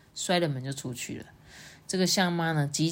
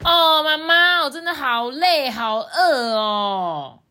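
high, loud whine, last word drawn out; 抱怨的小象哥哥; 9 semitones higher, 7 dB louder, slower than usual. A narrator's reading. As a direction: whine to mom like a tired, hungry kid. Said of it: "嘴巴就在那边说". (又高又拖长音地喊累喊饿)

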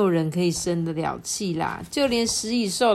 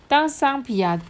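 plain reading voice

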